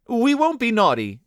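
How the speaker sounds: put-on high, urgent children's promise; crying out. A narrator's reading. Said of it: "they cried".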